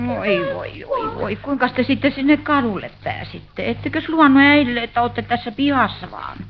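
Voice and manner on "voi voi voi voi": weary lament. Acted. Sick mother frets over dirty boys.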